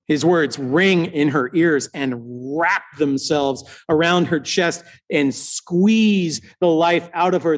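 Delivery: ordinary talk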